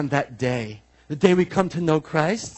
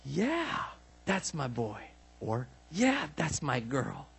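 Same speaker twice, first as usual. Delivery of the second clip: soft, hushed and fond, like a proud dad; gentle murmured praise from a pleased father; tender proud father; 11 dB quieter, slower than usual. Pretend. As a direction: lean in and quietly beam over your child. God voiced delighting in us.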